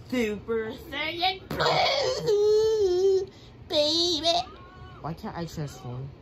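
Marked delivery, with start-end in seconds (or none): 0.1-4.4 high, loud, drawn-out scream; transformation scream. Acted. Gamer powering up his fighter, a long screaming battle cry.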